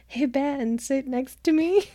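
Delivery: high, slowed-down coaxing imitation; mock casual invitation. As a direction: act out an over-innocent invitation. Acts out a strategic seating invitation.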